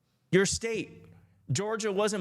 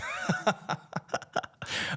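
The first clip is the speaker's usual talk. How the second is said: airy laugh; 4 dB quieter than usual. Real, not acted. Laughing at donation feature.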